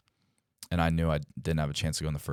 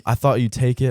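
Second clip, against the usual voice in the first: much higher and louder mock apology; loud teasing agent; 7 semitones higher, 9 dB louder than usual. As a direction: belt out the agent's fake apology with a grin. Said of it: "he's got a Southern accent. I can't even do him".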